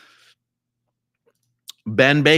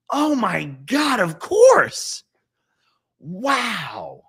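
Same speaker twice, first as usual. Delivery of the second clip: much higher, slow gasp of sudden understanding; amazed realisation; 9 semitones higher, 5 dB louder, much slower than usual. Real, not acted. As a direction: let the penny drop loudly. Realisation reading comment.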